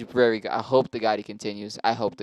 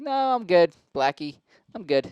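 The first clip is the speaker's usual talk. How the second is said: put-on girl declining offer; 6 semitones higher, 4 dB louder than usual. Voices the girl turning him down.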